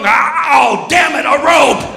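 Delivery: high, frustrated mock roar; be a shark foiled mid-attack; thwarted shark. Voicing shark thwarted by rope.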